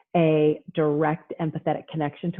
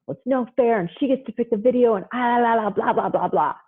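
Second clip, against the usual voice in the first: higher-pitched mock kid protest; upset child complaining; 6 semitones higher than usual. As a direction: imitate a child griping, trailing into blah-blah.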